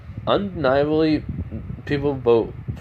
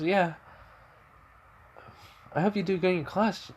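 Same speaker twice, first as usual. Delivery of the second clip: put-on light, higher girlish voice; Toga's voice; 9 semitones higher, 5 dB quieter than usual. Toga greeting Midoriya in a raised girlish voice. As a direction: pitch up a bit for the girl. Toga wishes Deku well.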